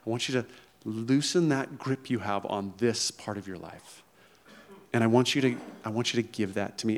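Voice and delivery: soft, hushed invitation; almost whisper let go; gentle voice of God. Pretend. God inviting a listener to loosen their grip, hushed. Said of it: "hear God today say".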